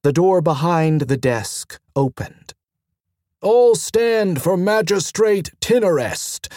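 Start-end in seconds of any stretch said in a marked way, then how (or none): 3.4-6.5 higher-pitched formal call to attention. A narrator's reading. Third guard announces the magistrate's entrance, called out. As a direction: call the room to order, pitched up. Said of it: "called the third guard".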